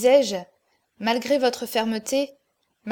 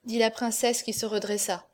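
ordinary delivery

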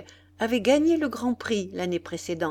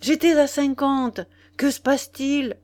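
higher, louder astonished protest; stupefied; 5 semitones higher, 6 dB louder than usual. A narrator's reading. Nana questions the sudden change in odds, stupefied. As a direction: demand an explanation, taken aback. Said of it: "cria-t-elle stupéfaite".

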